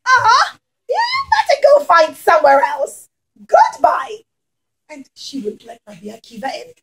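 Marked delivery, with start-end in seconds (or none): 0.0-4.2 high, dismissive refusal voice; unfriendly homeowner. Acted. Homeowner turning Rabbi Akiva away, high and dismissive. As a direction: shoo him off from the doorway.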